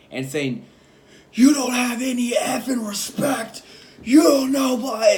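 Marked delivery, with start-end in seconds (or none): 1.3-5.1 high, loud, drawn-out angry shout; imitated screaming. Coworker screaming at me about respect, imitated screaming. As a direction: mimic a furious coworker screaming. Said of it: "like, screaming at me".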